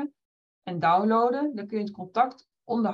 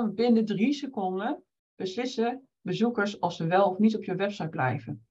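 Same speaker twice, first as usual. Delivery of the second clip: ordinary talk, nothing marked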